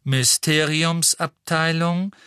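slow, cool announcing voice; cool female voice. A narrator's reading. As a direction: announce it coolly, like a lift. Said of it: "sagte die kühle Frauenstimme".

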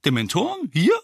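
dumbfounded double question; bewildered. A narrator's reading. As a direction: ask it as if you can't believe your ears. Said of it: "wiederholte Mandanges verdattert".

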